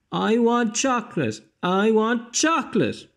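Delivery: high, loud tantrum scream; bawling tantrum. Acted. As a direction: bawl it like a furious little girl. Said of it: "bawled the girl".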